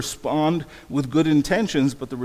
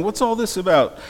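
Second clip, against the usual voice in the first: high, raised-voice question; shouting; 7 semitones higher, 5 dB louder than usual. A narrator's reading. Crowd rushes in to the hatched egg, shouting. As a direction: call it out like an amazed onlooker. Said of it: "the people came shouting".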